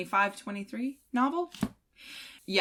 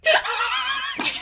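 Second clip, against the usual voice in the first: high squeal laugh; 6 dB louder than usual. Retold or imitated. Mimicking giddy reader.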